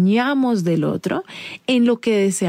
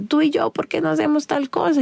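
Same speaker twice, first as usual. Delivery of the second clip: a pitched-up, whiny reproach; high-pitched complaint; 8 semitones higher than usual. Retold or imitated. Complaining to her husband about what others do, whiny high reproach. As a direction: nag him in a high voice.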